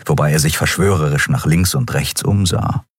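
usual speaking voice

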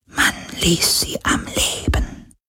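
a displeased spirit breathing the words; poltergeist. Acted. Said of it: "flüsterte der Geist des Meeres".

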